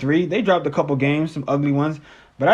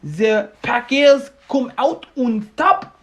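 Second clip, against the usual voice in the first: hammed-up French accent, higher and slower; over-the-top fake French accent; mock French accent; 8 semitones higher, slower than usual. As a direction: play a bad French sportscaster. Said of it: "I don't know if I'm French or, or German now".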